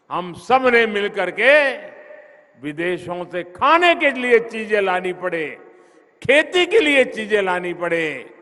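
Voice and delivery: loud, rising rallying cry; booming political rally speech. Real, not acted. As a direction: build each repeat to the crowd. Repeated rallying call to crowd.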